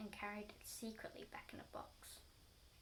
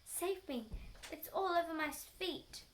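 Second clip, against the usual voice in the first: higher and louder pleading; panicked plea; 5 semitones higher, 4 dB louder than usual. Acted. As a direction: beg for rescue in a fright. Wife panics about creature.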